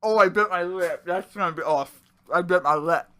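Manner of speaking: pained, through a mouthful; just bit your lip while chewing; mouth full. Real, not acted. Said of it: "talking with their mouths full".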